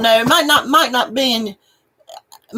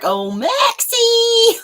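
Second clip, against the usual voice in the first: calling the cat; 7 semitones higher, much slower than usual. Retold or imitated. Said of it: "He's like".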